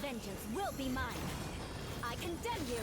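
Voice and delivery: vengeful battle shout. Acted. Attack shouts in combat.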